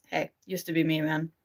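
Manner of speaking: ordinary talk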